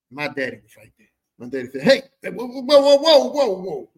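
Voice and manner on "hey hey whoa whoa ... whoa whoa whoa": loud, high volley of objections; acted indignant holler; alarmed dad. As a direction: cut in loudly to stop everything. Dad interrupts the phone insult.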